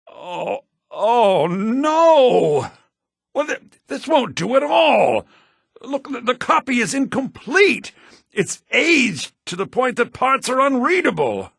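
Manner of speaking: panicked scholar fretting; dismayed alarm. Acted. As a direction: sputter in alarm over the ruined copy. Scholar finds damaged copy.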